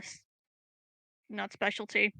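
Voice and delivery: ordinary delivery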